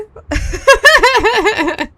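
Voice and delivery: high, loud burst of laughter; real giggling fit, high and rapid; cracking up. Real, not acted. Streamer laughing at own Fonzie bit, cracking up.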